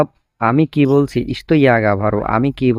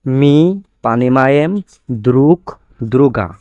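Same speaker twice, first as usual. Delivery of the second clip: word by word demo. Shown as a demonstration. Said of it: "এখানে দ্রুক দ্রুগা".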